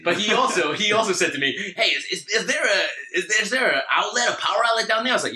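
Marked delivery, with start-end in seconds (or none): 1.8-5.1 high-pitched mock LA passenger; douchebag from LA. Seatmate asking for a power outlet, mock douchebag. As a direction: imitate an irritating seatmate, stumbling and pitched up. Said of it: "this absolute douchebag from L A".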